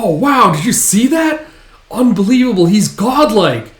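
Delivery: high, loud awe; acted amazed cheering. Band watches leader balance on a blade, amazed cheer. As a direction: gush like a thrilled spectator.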